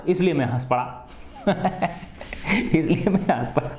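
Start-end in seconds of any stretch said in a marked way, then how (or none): 1.5-3.7 words breaking into a small laugh. Acted. Teacher voicing the student who laughed at the joke, laughing. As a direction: say it through a light chuckle. Laughs, then repeats the line.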